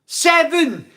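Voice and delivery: high, loud holler; hyped-up high-pitched call; excited countdown shout. Real, not acted. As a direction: yell the number to the crowd. Counting down to like target.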